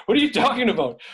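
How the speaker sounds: high, loud mock incredulity; incredulous. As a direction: imitate a baffled boss, pitch jumping up. Retells director's incredulous reaction.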